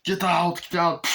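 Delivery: a hammed-up cop order. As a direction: clear the room loudly. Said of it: "And he goes in".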